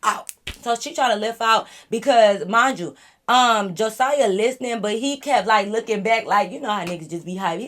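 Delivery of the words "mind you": ordinary talk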